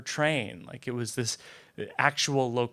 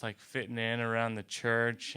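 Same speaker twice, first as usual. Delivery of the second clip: ordinary talk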